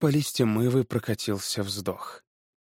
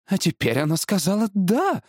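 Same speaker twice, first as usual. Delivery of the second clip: higher-pitched, alarmed wife; startled exclamation; 8 semitones higher than usual. A narrator's reading. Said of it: "воскликнула жена, испуганно". (испуганное восклицание)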